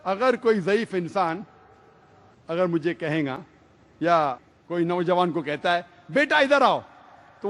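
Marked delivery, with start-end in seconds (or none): 6.1-6.8 put-on elder calling a boy. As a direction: call the lad over like a kindly elder. Elder calling a young man.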